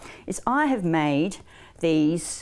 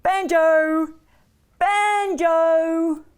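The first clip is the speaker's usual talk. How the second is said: long, loud singsong call; drawn-out storyteller's call; calling the dog; 9 semitones higher, 7 dB louder, much slower than usual. A narrator's reading. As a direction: call a dog across the yard. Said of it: "So Lucy called the dog".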